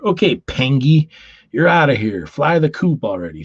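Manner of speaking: mock prison guard, high and loud; hammy guard shooing him out.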